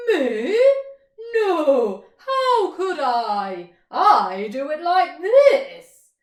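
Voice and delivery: loud, slow neighing horse voice; whinny the words out; big horse voice. Acted. Horse answering the mole, loud and slow.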